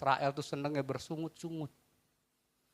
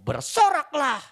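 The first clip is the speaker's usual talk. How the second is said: loud joyful command to shout; 11 dB louder, slower than usual. Acted. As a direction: call out like a battle cry. (seruan keras bersorak)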